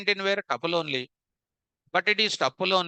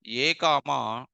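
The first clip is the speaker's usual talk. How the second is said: slow dictation; 3 semitones lower, slower than usual. Shown as a demonstration. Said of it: "I'm writing here".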